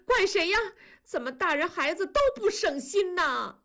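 wife turns on husband through tears, pitched high; crying reproach. A narrator's reading. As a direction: sob out blame at the husband. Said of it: "文丽大声地哭了起来".

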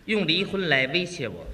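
very low, grave put-on voice; stern husband. Husband answers wife's divorce threat, stern. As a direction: intone with mock manly menace.

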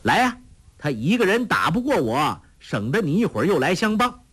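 high, quickening taunt; cocky fighter egging her on; goading shout. A narrator's reading. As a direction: call out the challenge, pitch up, hurrying at the end. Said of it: "尹志平叫道".

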